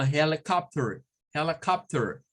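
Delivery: helicopter in an American accent, rolled final r; American accent, r sounded at the end. Shown as a demonstration. Teacher showing how an American says it. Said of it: "Un norteamericano o alguien que hable inglés rótico".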